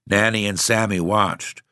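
ordinary delivery

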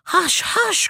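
high-pitched urgent shushing; crying out. A narrator's reading. Man shooting in the stream hushes them, crying out. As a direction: cry it out high, without extra volume. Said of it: "cried the man".

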